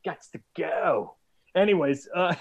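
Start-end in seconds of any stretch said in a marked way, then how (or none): 0.1-1.2 slow, drawn-out "Gots to go"; mock exit line, very slow; slow spin on go. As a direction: draw out "Gots to go" lazily. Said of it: "put my own personal spin".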